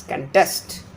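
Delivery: contest with the stress on -TEST; conTEST, weak first syllable, strong second; verb stress. Shown as a demonstration. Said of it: "difference between the pronunciation of the noun and the verb".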